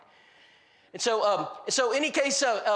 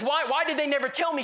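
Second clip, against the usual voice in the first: higher-pitched indignant demand; mildly acted indignation; mock angry woman; 6 semitones higher, 3 dB louder than usual. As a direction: imitate an upset wife demanding answers. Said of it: "Mary's angry".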